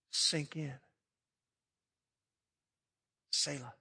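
soft, hushed pause; quiet reflection. Acted. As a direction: let it settle gently and softly. Pause to let words sink.

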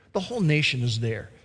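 normal talking voice

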